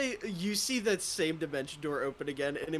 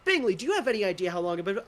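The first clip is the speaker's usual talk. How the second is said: higher, fast, exasperated scolding; impatient drow; 6 semitones higher, 5 dB louder, faster than usual. Acted. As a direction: rush it like a man kept waiting. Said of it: "he looks down and he goes".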